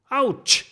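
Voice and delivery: high loud ouch; pained ouch. Acted. Kokosnuss crying out in pain after the fall. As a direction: cry out from the bump. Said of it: "stöhnt Kokosnuss".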